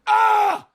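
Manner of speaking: sudden high, loud cry of pain; pained scream. Real, not acted. Streamer burning from hot chip, real pained scream.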